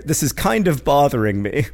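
normal talking voice

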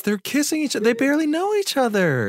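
high, incredulous outburst; shocked. Acted. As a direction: gasp it in disbelief. Character shocked at kiss.

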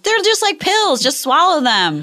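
high, coaxing mock voice. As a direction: coax like pushy hosts. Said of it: "They were, like, saying".

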